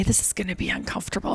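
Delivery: almost whispered, gentle doctor; hushed warning. Retold or imitated. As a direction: breathe it softly so she isn't scared. Kind doctor warning of discomfort.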